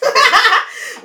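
high, loud burst of laughter; big real laugh. Real, not acted. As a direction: crack up out loud. Laughing at long episode.

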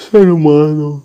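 talking through a yawn; words stretched by a big yawn. Real, not acted. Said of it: "peço desculpas pelo bocejo".

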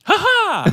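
high, loud cartoon cackle; maniacal laugh. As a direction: cackle like a crazed cartoon character. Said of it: "Do the noise".

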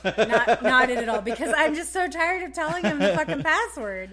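loud laughing confession. Real, not acted. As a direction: crack up as you admit it.

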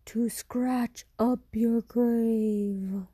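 creature voice. Acted. Creature answers the boy.

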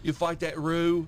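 loud, high, slow Aussie imitation; mock Australian accent. Asking mate about fighting a roo, mock Australian accent. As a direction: join in with a put-on Australian voice. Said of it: "how's Australian go".

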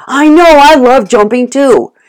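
high, loud, giddy dog voice; gleeful dog cheering along; excited dog. Pretend. Dog voice happily misreading the owner's scolding. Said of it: "the dog's going".

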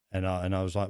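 ordinary talk

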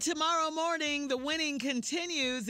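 big booming promo shout; booming prize announcer. Acted. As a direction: hype it like a radio game-show host. Radio promo, prize hype.

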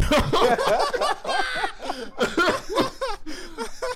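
big high-pitched burst of laughter. Real, not acted. Cracking up at the senior joke, high laugh. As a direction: crack up loudly.

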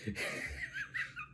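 high airy wheeze laugh; breathless laugh. Real, not acted. Laughing at their mistake.

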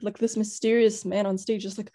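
usual speaking voice

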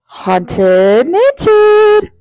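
high, very slow haunting wail; eerie ghostly announcer. Acted. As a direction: stretch it out like a ghost host. Spooky drawn-out episode title.

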